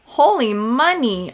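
loud, drawn-out delight; thrilled by the payout; slow, loud happy outburst; excited exclaim. Real, not acted. Thrilled by gnome sale cash.

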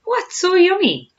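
high, loud, slow question; puzzled curiosity. A narrator's reading. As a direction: ask it high-pitched and drawn out, baffled. Hippo puzzled by crocodile's relish.

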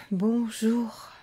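a bored, tired hello without looking up; weary. A narrator's reading. Girl answering the fairy, mildly weary. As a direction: sigh it out, can't be bothered. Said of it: "avec lassitude".

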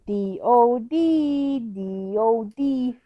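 each letter stretched, very slow; slow spelling. Real, not acted. Sounding out the letters on her ball.

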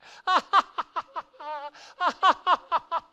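loud high crowd laugh; mocking laughter. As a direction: laugh him down hard. Friends laugh at him.

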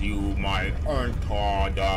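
slow, loud mock door voice; password at the door. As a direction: drag it out like a talking door. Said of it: "Just imagine the door said that to you".

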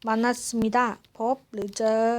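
usual speaking voice

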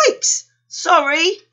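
high, loud yelp of alarm; yelp, then apologise up high; startled apology. A narrator's reading. Panda upsets the peacock, startled apology.

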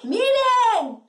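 high, drawn-out call from afar; neighbour's shout. Acted. Neighbour calling to the washerwomen from afar, shouting. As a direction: call out to get everyone looking. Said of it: "grita un vecino y dice".

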